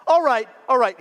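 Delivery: lightly acted king, high and loud start; fed-up king. King losing patience with his court, exasperated.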